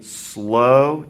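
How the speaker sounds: "slow" stretched long, then "to fast" after it; drawn-out "slow". Shown as a demonstration. Teacher explaining playing slow to fast, drawn-out "slow". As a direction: draw out the word "slow" to show slowness. Word drawn out to mirror slowness.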